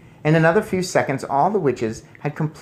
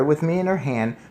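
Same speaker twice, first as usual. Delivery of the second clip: ordinary delivery, nothing marked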